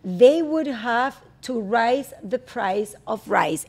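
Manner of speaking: careful, halting word-by-word English; slow repetition. Shown as a demonstration. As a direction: repeat slowly like a careful learner. Host repeats the drill slowly.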